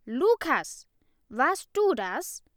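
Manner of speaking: high, loud, slow grilling of a suspect; angry interrogation. A narrator's reading. Ida grills Lukas at his desk, loud and angry.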